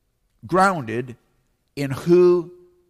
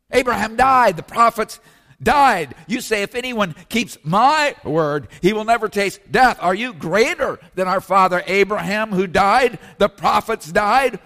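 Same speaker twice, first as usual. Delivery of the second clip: higher and louder, indignant challenge; indignant accusers; 5 semitones higher, 6 dB louder than usual. Retold or imitated. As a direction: throw the accusation back at him.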